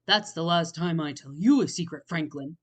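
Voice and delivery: angry parting shot. Acted. Bear angry after flag tears.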